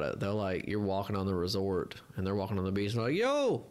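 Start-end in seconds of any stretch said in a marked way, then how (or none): 3.2-3.6 high-pitched mock Jamaican hawker call; bad Jamaican accent. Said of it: "That's not a good Jamaican accent".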